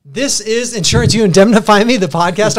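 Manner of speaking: high, loud show-opening voice; podcast announcer. Acted. Host opening the podcast for listeners, upbeat announcer voice.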